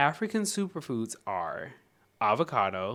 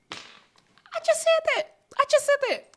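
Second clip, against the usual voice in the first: shrill mock-outraged squeak; high squeaky mock voice; 8 dB louder than usual. To the book, a high squeaky mock protest.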